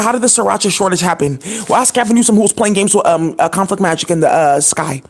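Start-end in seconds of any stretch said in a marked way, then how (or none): none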